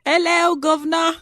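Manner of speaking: high-pitched, hammed-up British greeting; bad British accent. Actress clowning for her co-host, bad British accent. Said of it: "that's my bad British accent".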